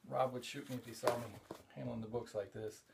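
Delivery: normal talking voice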